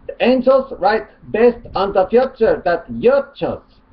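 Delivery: high, loud put-on Arabic accent; mock Arabic accent.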